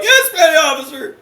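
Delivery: high, loud recruit's yelp; frantic obedience. Retold or imitated. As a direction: answer your superior in mock panic. Recruit obeys scolding petty officer.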